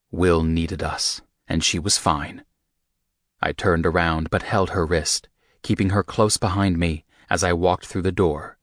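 ordinary delivery, nothing marked

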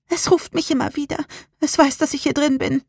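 put-on high, scared child's voice; whimpering. A narrator's reading. Rebecca hiding as something keeps calling her, whimpering. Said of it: "wimmerte Rebecca".

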